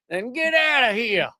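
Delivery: mocking politician with a Southern twang. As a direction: put on a hammed-up Southern accent. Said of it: "I added the slightly Southern accent".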